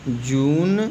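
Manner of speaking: जून with a drawn-out 'oo'; long oo. Shown as a demonstration. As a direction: stretch the vowel of जून. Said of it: "इसको जुन नहीं पढ़ेंगे". (लंबे 'ऊ' वाला जून)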